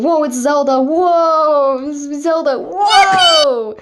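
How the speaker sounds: loud high reveal whoa; excited shout. Real, not acted.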